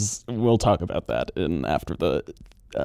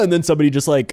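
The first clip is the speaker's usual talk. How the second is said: usual speaking voice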